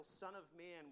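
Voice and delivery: usual speaking voice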